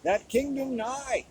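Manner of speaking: slow, grand archaic recitation with long pauses; lightly hammed-up solemn verse; mock poem recital. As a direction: declaim like a bard in a mock-heroic poem.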